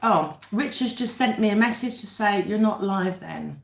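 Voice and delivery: ordinary talk, nothing marked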